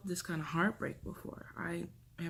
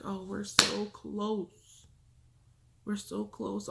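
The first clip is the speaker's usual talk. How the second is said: drawn-out, higher-pitched eager voice; retold excitement; 4 semitones higher, much slower than usual. Recalling the countdown to the gender reveal, excited. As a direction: stretch it out with mild excitement. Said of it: "I was so excited".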